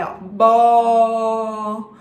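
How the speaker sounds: "Ó, bó" drawn out loud, stressing the vowel; sounding out words. Shown as a demonstration. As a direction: model the vowel sound "bó" for a child. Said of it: "com que letra que começa".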